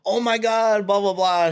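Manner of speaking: high-pitched mock excitement; imitated gushing. Storyteller imitates the gym woman warming up about her tattooist, mock gushing. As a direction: switch into her suddenly chatty gush. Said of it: "Immediately changed everything".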